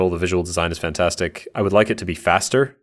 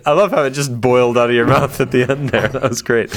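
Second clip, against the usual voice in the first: amused; 5 semitones higher, 4 dB louder than usual. Real, not acted. Still amused at co-host's flub.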